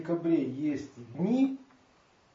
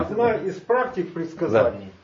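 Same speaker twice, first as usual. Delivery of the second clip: to someone hard of hearing; 2 semitones higher, 8 dB louder than usual. Real, not acted. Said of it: "Я плохо слышу".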